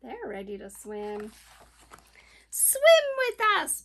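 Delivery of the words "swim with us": high, loud otter call; lightly voiced otter urging a friend on; barking otter. A narrator's reading. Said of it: "barked Otter".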